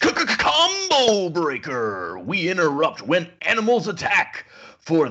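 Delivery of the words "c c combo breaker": a stuttered arcade announcer; mock announcer stutter. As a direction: stammer the c like a game announcer. Mock stutter game announcer bit.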